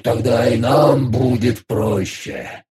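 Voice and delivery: slow, brightened gurgling creature; gurgling alien voice. Acted. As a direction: gurgle it, a bit cheered up. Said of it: "повеселел мастер".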